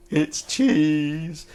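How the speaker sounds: amused repeat of "It's cheese"; laughing. Real, not acted. Laughs at the same find.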